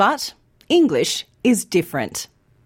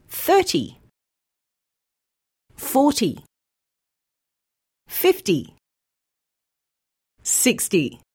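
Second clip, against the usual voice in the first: thirty forty fifty sixty, first syllable long, ty short; stress on first syllable; 4 semitones higher than usual. Shown as a demonstration. Teacher modelling ty numbers for learners, first syllable long. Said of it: "say these words with me".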